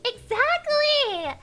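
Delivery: slow, high, loud exactly; drawn-out exclaim. Real, not acted. Streamer cheering chat's joke, slow high loud exclaim. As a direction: stretch the word out triumphantly. Agreeing loudly with chat.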